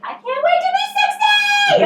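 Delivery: high, drawn-out gleeful shout; excited shout. Retold or imitated. Eager child dreaming of being old, high excited shout. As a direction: yell it out like you can hardly wait. Enthusiastic quote of younger self.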